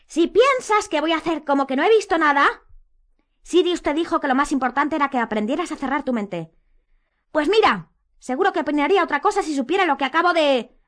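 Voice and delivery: high, loud heated rebuttal; angry arguing. A narrator's reading. Quarrel, Harry cuts Hermione off.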